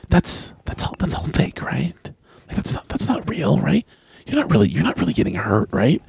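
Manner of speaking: hushed, breathy whisper of a curious stranger; mock whisper. Stranger secretly whispering to the wrestler. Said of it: "they almost, like, whisper".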